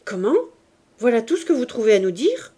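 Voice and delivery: revolted, demand it back fast; outraged. Acted. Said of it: "Révolté".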